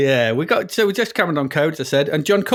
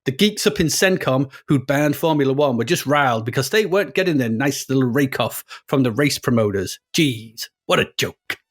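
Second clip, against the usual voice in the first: hammed-up American racer's narration, somewhere in America; American accent. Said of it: "it's somewhere in America".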